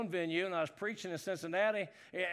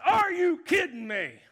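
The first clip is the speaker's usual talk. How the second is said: shouted disbelief at the TV; loud yell; 7 semitones higher, 8 dB louder than usual. Retold or imitated. Fan yelling at a bad game, loud shout. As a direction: yell it at the sorry team. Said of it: "And I got loud".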